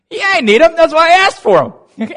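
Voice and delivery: high, loud, strained outburst; comic parental exasperation; exasperated parent. Retold or imitated. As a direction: snap back in a squeaky, worked-up voice. Exasperated parent voiced in story.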